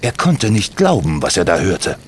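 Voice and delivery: plain reading voice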